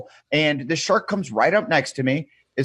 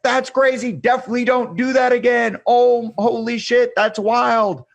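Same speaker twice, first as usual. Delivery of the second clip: higher, louder, thrilled and disbelieving; excited guide; 9 semitones higher, 4 dB louder than usual. Retold or imitated. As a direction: gush like a stunned dive guide. Guide reacts to shark ride.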